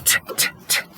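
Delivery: soft unvoiced t t t; whispered t sounds. Shown as a demonstration. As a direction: tap out the t sound in a breath. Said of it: "starts with the t, t, t sound".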